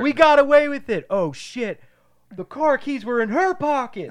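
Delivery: high, loud triumphant yell then dismay; excited shout. Acted. Two movie teens yelling over their getaway.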